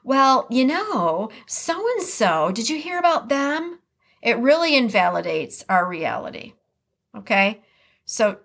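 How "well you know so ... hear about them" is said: dismissive gossip. Retold or imitated. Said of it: "another person says".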